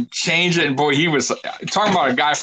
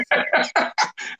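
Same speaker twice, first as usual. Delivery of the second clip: quiet unvoiced chuckle; breathy laugh; 4 dB quieter than usual. Real, not acted. Laughing mid impression.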